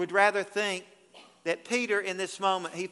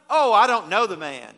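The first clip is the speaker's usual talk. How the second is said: denial; 8 dB louder than usual. Retold or imitated. Peter denying Jesus.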